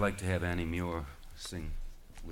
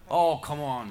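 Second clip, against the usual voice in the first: louder, higher scoffing groan; 8 semitones higher, 9 dB louder than usual. A narrator's reading. Charlie dismisses the sea serpent, loud scoff. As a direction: brush him off with a loud scoff.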